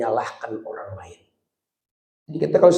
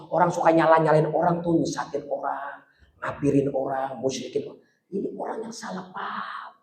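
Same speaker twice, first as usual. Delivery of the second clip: hushed warning; 2 semitones higher, 8 dB quieter than usual. Acted.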